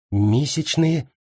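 uncomprehending query, rising at the end; puzzled echo question. A narrator's reading. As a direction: ask it back, not understanding. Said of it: "переспросила Кэрри".

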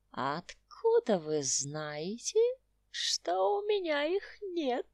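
put-on slow sly old man's giggle; old prospector. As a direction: tease like a cackling old geezer. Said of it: "хихикнул старикашка".

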